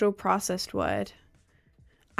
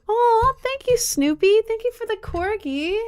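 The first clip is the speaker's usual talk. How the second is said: very high, loud squeaky thanks; gushing thanks; 13 semitones higher, 5 dB louder, slower than usual. Real, not acted. Streamer thanking viewer for a corgi, squealing. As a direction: squeal your thanks over the cute gift.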